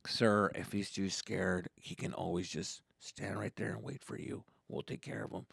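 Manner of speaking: low, soft, measured employee voice; calm ride attendant. Acted. Worker offers dad a solution, calm and low. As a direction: imitate an unruffled worker, quietly.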